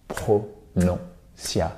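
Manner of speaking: slow, soft, separated syllables; deliberate, hushed word reveal. Shown as a demonstration. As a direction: break it up with pauses, dropping low and soft. Key word spelt out for emphasis.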